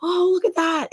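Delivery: lightly voiced six-year-old; imitating a small child. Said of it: "hear your six-year-old son go".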